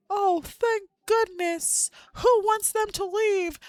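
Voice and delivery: high, loud mock chorus of schoolkids; whole class jeering. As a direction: imitate kids crowing in exaggerated relief, high and loud. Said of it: "the whole class is like".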